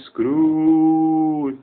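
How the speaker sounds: llamado fantasmal, lento y arrastrado; llámalo como un espectro desde la puerta; voz fantasmal. Retold or imitated. (la aldaba llama a Scrooge con voz de espectro)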